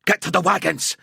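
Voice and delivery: ordinary delivery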